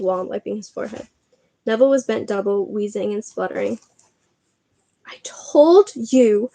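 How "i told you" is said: breathless, slow whisper; gasped whisper. Acted. Hermione gasping after the run, breathless whisper. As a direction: gasp it out with no breath left. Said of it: "Hermione gasped".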